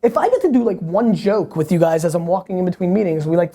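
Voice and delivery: ordinary delivery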